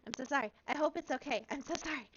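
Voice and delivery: put-on high, rushed little-girl apology; panicked apology. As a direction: blurt the sorries in a high panic.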